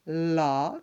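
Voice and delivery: "La" loud and held on its own; isolated syllable. Shown as a demonstration. Teacher counting off syllables, loud and separate. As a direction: stretch "La" as a separate beat. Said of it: "troisième syllabe".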